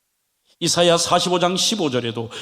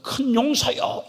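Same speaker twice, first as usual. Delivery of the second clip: calling God's voice; 6 semitones higher, slower than usual. Retold or imitated. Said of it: "부르는 주님의 음성은".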